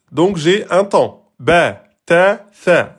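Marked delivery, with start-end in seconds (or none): 1.4-2.9 "Ba", "ta", "sa", one beat each; short vowels. Shown as a demonstration. Teacher demonstrating one beat, short "Ba, ta, sa". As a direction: separate short syllables "Ba, ta, sa". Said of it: "C'est du un temps".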